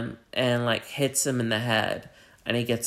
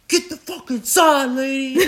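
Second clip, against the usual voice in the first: high, loud shouted order; bellowed, high-pitched command; robber's yell; 13 semitones higher, 6 dB louder than usual. Retold or imitated. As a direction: yell it like an armed robber storming in. Robber yelling at women.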